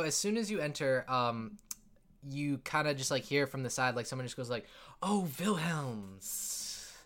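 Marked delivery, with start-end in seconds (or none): 5.0-6.9 a drawn-out, slow purr of a greeting; slow sultry woman. Acted. Yuan-Ti hostess greets the returning Wilhelm, slow and seductive. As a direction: lure him in, slow and teasing. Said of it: "attractive looking Yuan-Ti woman".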